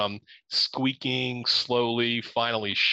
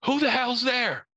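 swearing shout; 13 semitones higher than usual. Retold or imitated. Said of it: "I'm swearing at this point".

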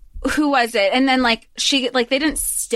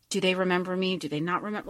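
lower, much softer inner thought; hushed wondering; 6 semitones lower, 9 dB quieter, faster than usual. Retold or imitated. Lovers glancing away after years apart, hushed and lower. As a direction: murmur it to yourself, unsure.

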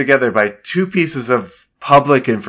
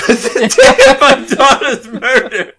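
high, loud laughing repeat; 13 semitones higher, 6 dB louder than usual. Real, not acted. Teasing a friend's botched Godfather quote, laughing through it. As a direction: crack up while echoing the botched line.

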